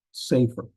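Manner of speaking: safer with stress on the first syllable, "SAY-fer". Shown as a demonstration. Teacher corrects the accent for students, safer stressed on its first syllable. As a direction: hit the start of safer, let "fer" drop. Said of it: "accent on the first syllable".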